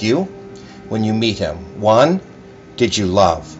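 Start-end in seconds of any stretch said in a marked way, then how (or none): none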